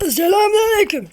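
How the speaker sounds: high, loud comic salute; high hammed-up greeting. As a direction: belt it as a cartoon greeting. (yüksek, abartılı selam)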